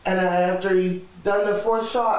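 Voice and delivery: ordinary talk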